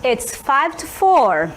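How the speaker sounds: slow, careful repeated answer; slow clear answer. Shown as a demonstration. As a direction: drill the answer slowly. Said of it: "ela vai dizer". (resposta lenta e repetida)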